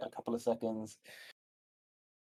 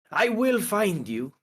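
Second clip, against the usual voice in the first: much higher, louder, slow menacing vow; vow to find; 10 semitones higher, 4 dB louder, slower than usual. Acted. Turok searching the crumbling room, vowing slowly and loudly to find him. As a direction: spit each word out as a promise. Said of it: "looks throughout the surrounding and says".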